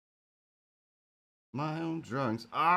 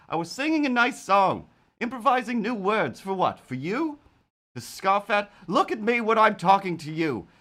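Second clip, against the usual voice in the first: mock indignant scolding; 6 semitones higher, 5 dB louder than usual.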